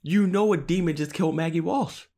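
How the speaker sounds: exasperated. Real, not acted. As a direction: pitch rising in frustration at him. Exasperated at Forrest's choice.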